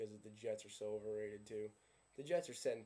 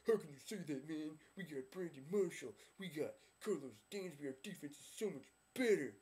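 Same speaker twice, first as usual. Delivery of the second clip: higher-pitched, cocky fan voice, imitating; mock fan gushing about his team; mock Dolphins fan; 5 semitones higher than usual. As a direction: brag like a smug Miami fan online. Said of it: "you're like".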